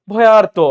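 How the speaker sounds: ভয়ার্ত loud, the "aar" opened out; demonstrated pronunciation. Shown as a demonstration. Teacher has students pronounce it themselves, ভয়ার্ত loud and clear. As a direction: sound out ভয়ার্ত strongly on aar. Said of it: "নিজে নিজে উচ্চারণ করেন তো".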